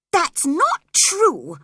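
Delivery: loud, high, slow protest; sick boy's loud protest; cried out. Acted. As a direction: cry out in hurt denial. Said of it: "cried the boy".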